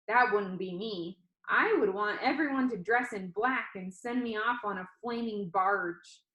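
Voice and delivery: ordinary delivery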